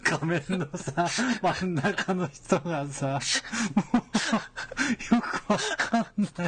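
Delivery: slow words through laughter; laughing. Real, not acted. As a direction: speak slowly while laughing. Laughing at the screen. (笑いながらゆっくり)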